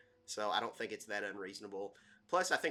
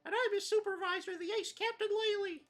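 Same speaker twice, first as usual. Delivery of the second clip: very high, squeaky self-important introduction; boastful cartoon sidekick; 5 dB louder than usual. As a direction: announce yourself proudly in a tiny high voice.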